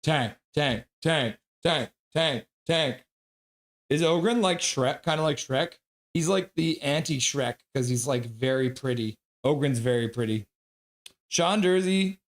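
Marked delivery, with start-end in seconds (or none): none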